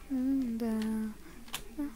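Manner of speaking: usual speaking voice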